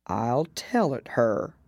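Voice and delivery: low, slow hollow turtle voice; deep hollow tone. A narrator's reading. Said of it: "in a deep, hollow tone".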